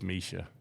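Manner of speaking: Misha said as MEE-shuh; correcting to a long-E Misha. Shown as a demonstration. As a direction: stretch the first vowel of Misha to ee. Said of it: "why is it elongated E".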